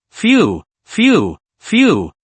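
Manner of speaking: labored gasping breaths; heavy panting. A narrator's reading. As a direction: pant hard, out of breath. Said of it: "his breath coming in heavy, labored gasps".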